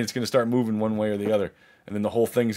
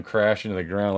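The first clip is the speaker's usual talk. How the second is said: ordinary delivery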